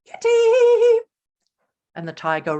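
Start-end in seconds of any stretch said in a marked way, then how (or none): 0.0-1.0 high, loud drawn-out call; loud singsong cat call; gleeful call. A narrator's reading. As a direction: call out like a giggling princess. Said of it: "Precious called out".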